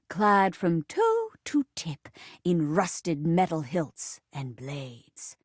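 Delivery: very low, ominous storyteller voice; dramatic story narration. Acted. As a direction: narrate it deep and weighty. Narrates the play.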